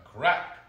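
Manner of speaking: sudden loud, high crack; loud snapping crack. A narrator's reading. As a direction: voice the snap at full punch. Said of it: "a loud snapping sound".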